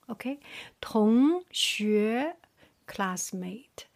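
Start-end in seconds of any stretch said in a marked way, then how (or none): 0.8-2.4 同学 with both syllables rising; two second tones. Shown as a demonstration. Teacher showing second tone pairs, 同 and 学 both rising. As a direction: sweep 同 upward, then 学 upward too. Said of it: "second line for the second tone".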